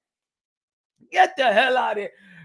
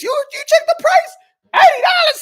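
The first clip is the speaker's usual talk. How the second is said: shocked; 14 semitones higher, 4 dB louder than usual. Real, not acted. Shocked at pay-per-view price.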